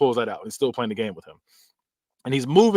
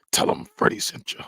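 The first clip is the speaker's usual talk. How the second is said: Freddy. Retold or imitated. Quotes Freddy's menacing parting line.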